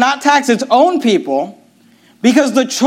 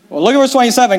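ordinary delivery

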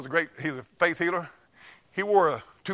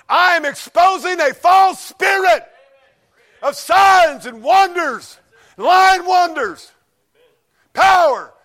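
louder, higher, slow hammering sermon shout; full force preaching; 9 semitones higher, 7 dB louder, slower than usual. Acted. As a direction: thunder it from the pulpit.

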